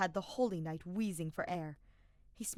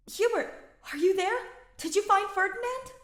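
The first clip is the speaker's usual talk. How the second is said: much higher and louder call; 11 dB louder than usual. Acted. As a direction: call clearly through the speaker. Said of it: "much louder this time".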